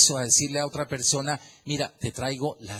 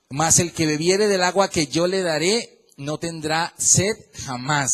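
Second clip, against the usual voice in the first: louder, ringing Bible-verse read; scripture reading; 2 semitones higher, 5 dB louder than usual. Acted. Pastor reading John to the church, ringing proclamation. Preacher reads the verse aloud.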